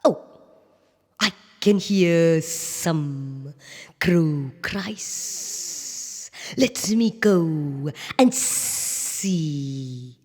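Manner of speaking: very slow, soft, sneaky snake voice; sly hungry snake. Acted. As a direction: creep the words out like a snake. Snake voiced hunting prey.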